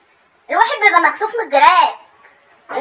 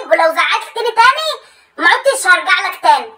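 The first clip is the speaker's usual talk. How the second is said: usual speaking voice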